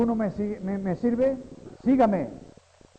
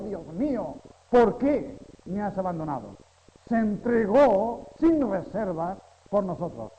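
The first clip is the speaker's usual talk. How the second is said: preaching at full force; 4 semitones higher, 5 dB louder than usual. Acted. Preacher to his congregation, in fervent full-force preaching.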